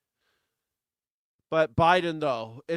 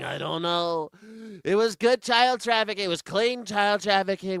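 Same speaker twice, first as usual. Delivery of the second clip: high, loud boastful impression; mock Trump voice; 6 semitones higher, 4 dB louder than usual. Sending up Trump bragging, high and loud. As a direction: brag like a smug politician.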